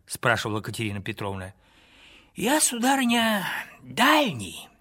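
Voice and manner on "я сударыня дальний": lightly voiced old wanderer, higher; a hint of a frail old pilgrim; gentle old man speaking from afar; old man's voice. A narrator's reading. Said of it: "отвечал другой старческий голос".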